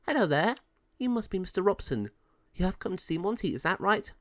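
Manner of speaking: high, fast officer voice; a chirpy officer greeting the visitor, high and quick; pound officer. A narrator's reading. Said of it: "Dog pound officer said".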